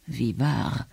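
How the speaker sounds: low, soft aside; murmuring. A narrator's reading. Markwald agrees aside after the delegate's complaint, murmuring. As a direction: murmur it under your breath. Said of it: "murmelte Markwald".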